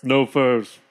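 low, deadpan refusal; unmoved chief, short and low; Red Owl. Acted. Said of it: "Red Owl says".

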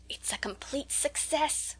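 breathless, thrilled whisper, slow; delighted secret whisper; ecstatic whisper. A narrator's reading. Rose gloating to her friends in the hall, ecstatic whisper. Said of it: "whispered Rose ecstatically".

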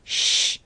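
soft voiceless shush; hushing shh. A narrator's reading. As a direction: hush a little brother. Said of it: "cried Minnie".

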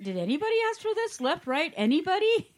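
high, loud questioning, rising at the end; imitated bewildered commenter, pitched right up; mock incredulous question. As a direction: call out to the room for any answer. Voicing commenters' exasperated question.

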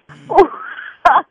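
loud high burst of laughter; big laugh. Real, not acted. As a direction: cackle at the reveal. Prank reveal laugh.

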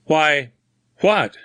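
high, halting question; broken, bewildered words; startled stammer. A narrator's reading. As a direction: stammer it out in alarm. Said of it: "stammered Grimsby".

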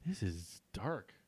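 soft, slow aside; quiet stunned. Real, not acted. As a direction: murmur in disbelief.

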